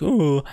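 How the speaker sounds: high, rounded "ooh" mouth-shape sound. Shown as a demonstration. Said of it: "that looks like he's like".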